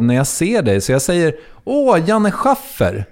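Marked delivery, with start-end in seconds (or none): 1.6-3.0 high, slow, star-struck gasp of his name; thrilled greeting. Retold or imitated. Fan meeting his idol at a premiere, high and thrilled. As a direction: gush over meeting your idol.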